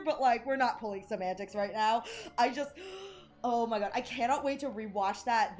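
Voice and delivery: normal talking voice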